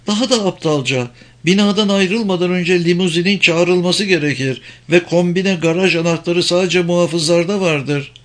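high-pitched, insistent woman; high female character voice; scornful German woman. Acted.

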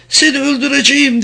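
high voice of an enraged captive woman; shouted threat. A narrator's reading. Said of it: "diye bağırdı Vicky".

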